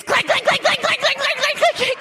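high, rapid mock clicks; imitated clicking. Mom mimics the jammed buggy lever clicking, frantic. Gear lever won't engage.

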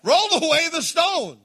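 high, loud command; commanding shout. Acted. Jesus ordering the stone rolled, loud command. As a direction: call it out with full authority.

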